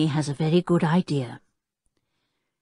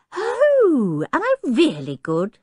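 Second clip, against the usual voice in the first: bright higher delighted question; pleased; 11 semitones higher, 4 dB louder, slower than usual. A narrator's reading. Tinker pleased that Julian calls him good at noises. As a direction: Tinker thrilled by the praise.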